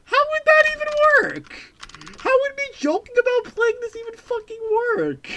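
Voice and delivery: high, loud incredulous squeal; exasperated squeal. Real, not acted. Exasperated at chat.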